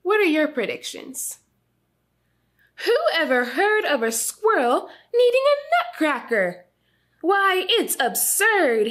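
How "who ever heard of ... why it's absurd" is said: a mildly voiced mother scolding, higher. A narrator's reading. Mother scolds her son about the nutcracker, mildly indignant. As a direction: tut at the silliness, mock outrage. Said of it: "his mother scolded".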